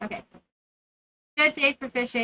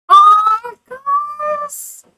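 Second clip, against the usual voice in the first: much higher, loud oh Gus; exaggerated surprise; 13 semitones higher, 16 dB louder than usual. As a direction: gush at Gus. Reacting to character.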